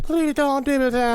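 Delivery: a hint of a gentle woman; female voice. The female alien asking Ed not to photograph. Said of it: "the female voice interjected".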